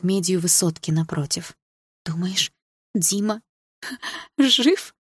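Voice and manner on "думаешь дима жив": halting words leaping high at the end; hoarse laugh. A narrator's reading. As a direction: ask it with a husky, disbelieving laugh. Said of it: "хрипло рассмеялась Саша". (с хриплым смешком)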